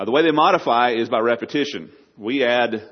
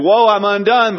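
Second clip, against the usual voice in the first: much higher and louder, a wailing cry of despair; anguished cry; 10 semitones higher, 9 dB louder, slower than usual. Acted. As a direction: cry out as one who is undone. Acting the sinner's cry.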